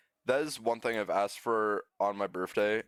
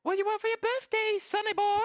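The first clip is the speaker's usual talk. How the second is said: high squeaky voice imitating a relative; mock relative voice. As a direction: squeak it out like a nosy aunt. Mocking relatives asking about birthday.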